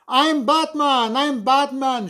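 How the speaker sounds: put-on high, loud boyish shriek, slowed; kid shrieking. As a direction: screech it like an excited eight-year-old hero. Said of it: "shrieked my eight-year-old brother Luke".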